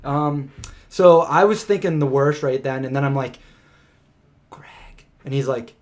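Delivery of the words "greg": unvoiced whisper of a name. Waking her partner in the tent while a bear is near, whispering. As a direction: barely breathe his name so nothing outside hears. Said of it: "I'm just being real quiet".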